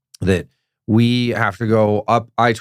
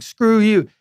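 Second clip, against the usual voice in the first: mock angry retort; 10 semitones higher than usual. Retold angry heckler answering back.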